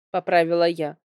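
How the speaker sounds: usual speaking voice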